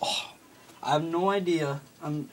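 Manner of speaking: usual speaking voice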